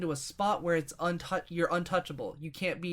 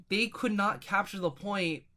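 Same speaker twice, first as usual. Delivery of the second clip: ordinary talk, nothing marked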